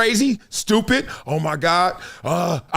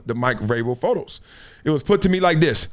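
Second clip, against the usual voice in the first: usual speaking voice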